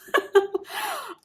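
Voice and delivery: high teary laugh. Real, not acted. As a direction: laugh through leftover tears. Laugh after crying.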